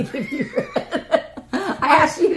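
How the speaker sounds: laughing. Real, not acted. Teasing over candy choice.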